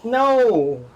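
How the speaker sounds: sudden high cry of alarm. Real, not acted. Gamer yelps at the fire in alarm. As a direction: yelp as the fire flares.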